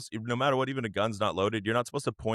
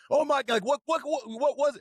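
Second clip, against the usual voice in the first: high, startled cops; mock alarmed cop voices. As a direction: gasp it out like rushing cops. Acted cops rushing in.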